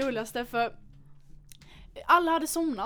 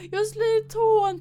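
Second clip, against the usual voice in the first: high, loud, slow mock wail; imitating herself sobbing over a stubbed toe; mock crying; 10 semitones higher, 8 dB louder, slower than usual. Girl retelling her crying over the stubbed toe, mock wail. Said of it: "jag bara grät".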